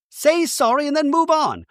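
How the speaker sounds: very high, insistent imitated command; mock scolding. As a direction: push for the apology in an exaggerated high voice. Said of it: "Bronwyn is like".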